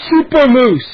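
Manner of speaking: loud, high triumphant roar; bellow. A narrator's reading. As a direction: bellow it like a boastful superhero. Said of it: "he bellowed".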